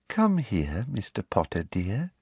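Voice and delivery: soft, sugary girlish coaxing, lightly voiced; sweetly girlish. A narrator's reading. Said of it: "in her softest, most sweetly girlish voice".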